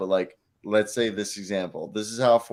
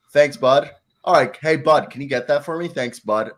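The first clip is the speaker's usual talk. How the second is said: louder, higher, mock-friendly condescension; condescending; 5 semitones higher, 5 dB louder than usual. Shown as a demonstration. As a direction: lean on Bud to needle someone who annoyed you. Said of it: "emphasize my Bud when I wanna sound condescending".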